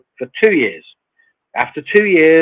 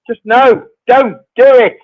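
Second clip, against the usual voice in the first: louder and higher, laughing firmly; emphatic no; 11 semitones higher, 11 dB louder than usual. Real, not acted. Guest warning viewers off copper, loud emphatic refusal. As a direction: shut the idea down hard.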